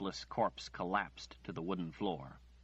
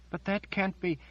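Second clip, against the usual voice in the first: high, louder, incredulous; dismayed protest; 12 semitones higher, 5 dB louder than usual. Acted. Margaret protests Nathan's refusal, high and loud. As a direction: push back in disbelief.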